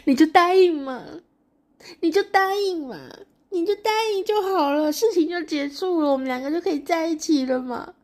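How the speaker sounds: high, loud tearful begging; crying plea. Acted. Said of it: "他就哭着说".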